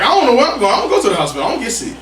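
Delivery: high, loud comic protest; retold protest. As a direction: replay your indignant refusal, voice swooping up. Retells own protest, laughing.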